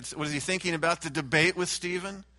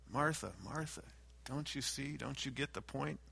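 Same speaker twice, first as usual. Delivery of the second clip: soft, gentle reproach, much quieter; hushed, tender chiding; soft rebuke; 11 dB quieter than usual. Acted. Jesus gently rebuking Martha, soft tender reproach.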